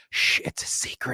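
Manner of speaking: hushed, secretive whisper; mock whisper. Telling a caller a secret in a mock whisper.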